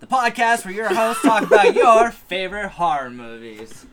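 loud, high, hammed-up show tagline; podcast announcer. Host announcing the show to listeners, hammed-up announcer. Resumes the sign-off, louder.

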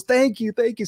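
mock beaming woman saying thanks; delighted lady thanks. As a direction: squeak out a grateful thank you. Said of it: "She goes".

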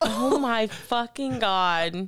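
very slow, stunned outburst; shocked disbelief. Real, not acted. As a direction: drag it out, horrified. Shocked by the jail letters.

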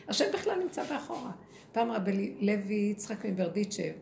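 ordinary talk, nothing marked

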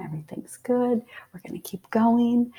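hushed, reassuring whisper; soft whisper. Retold or imitated. Laboring mother whispering reassurance to herself. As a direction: whisper it to calm yourself. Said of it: "And I was like".

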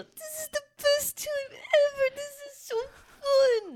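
very high, squealing mock drunk joy; drunk excitement. Imitating her drunk self at a party, high squeal. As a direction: squeal it like you're tipsy at a party. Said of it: "if I'm drunk and I feel that, I go".

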